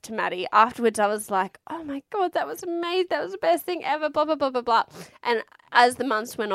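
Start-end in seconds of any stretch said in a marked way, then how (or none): 1.7-4.8 sing-song parody of a delighted new mum; mock gushing. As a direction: rave dreamily, rattling off into blahs. Retold gushing after first birth.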